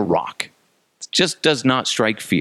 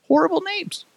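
very high-pitched little voice; squeaky repeat. Acted. Comic hammering his verdict on team names, in falsetto.

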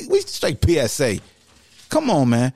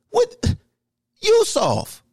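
outraged disbelief; much slower than usual. Real, not acted. Outraged at fans calling team soft.

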